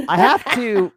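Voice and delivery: loud, a little high safety spiel; mock attendant yelling the safety briefing. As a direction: announce every word as if to the deaf. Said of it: "still yelling".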